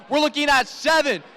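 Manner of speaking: high shouted countdown; dueling hype announcers. Real, not acted. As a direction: count it down with the crowd. Both count down final seconds.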